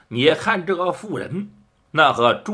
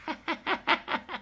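a short high sneering chuckle; cold sneering laugh; 12 semitones higher, 11 dB quieter than usual. A narrator's reading. Wife laughs coldly at the drugged old men, sneering laugh. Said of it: "呵呵一阵冷笑".

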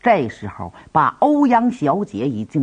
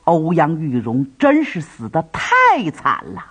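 ordinary delivery

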